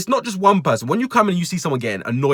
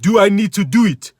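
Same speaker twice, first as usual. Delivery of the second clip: dim customer voice; 5 semitones higher, 5 dB louder than usual. Retold or imitated. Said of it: "He said".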